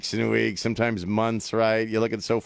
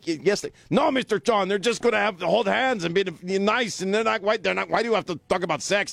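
higher, fast, flustered protest; mock wife protesting; 6 semitones higher than usual. Husband mimics wife objecting to sex talk, pitched-up protest. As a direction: play the indignant wife, a bit higher and quick. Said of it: "I'm like".

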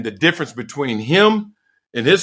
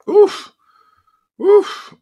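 loud high oof grunts; 6 dB louder than usual. Real, not acted. Comic weight gain noise.

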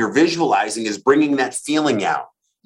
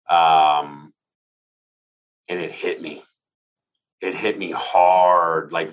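choked up; 6 semitones lower, slower than usual. Real, not acted. Moved recalling life-changing talk.

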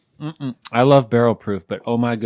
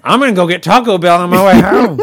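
higher, louder, laughing through it; 9 semitones higher, 12 dB louder than usual. Real, not acted. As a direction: laugh while saying it.

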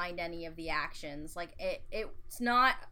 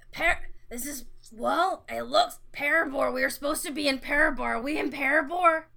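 high, loud panicked rush; 6 semitones higher, 7 dB louder, faster than usual. Acted. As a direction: panic as the words tumble out. Character panicking, confused.

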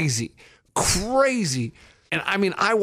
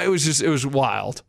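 normal talking voice

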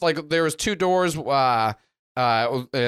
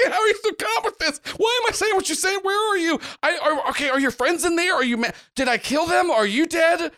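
put-on very high, frantic teen voice; high, rattled character voice; Shifting Sammy panicking; 12 semitones higher than usual. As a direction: pitch it way up and fire off worried questions.

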